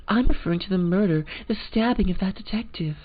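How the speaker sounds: posh American. Acted. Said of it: "posh American".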